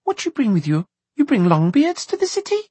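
put-on high child's voice, questioning; suspicious gatekeeper girl. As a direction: challenge them as a sceptical little girl.

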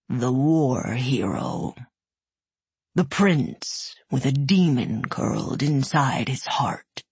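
slow rumble fading to a whisper; menacing dragon, ending hushed. Acted. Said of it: "Its voice was a low rumble".

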